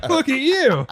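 very high-pitched feigned outrage; squeaky-high put-on indignation; mock accusation. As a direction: accuse them incredulously, hammed up. Falsely blaming man in crowd.

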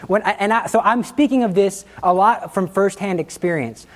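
ordinary talk

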